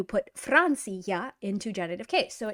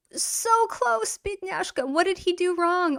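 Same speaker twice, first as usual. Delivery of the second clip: teasing sympathy; 8 semitones higher than usual. Real, not acted.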